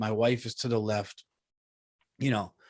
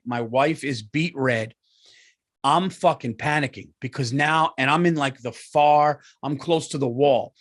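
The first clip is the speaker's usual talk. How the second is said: usual speaking voice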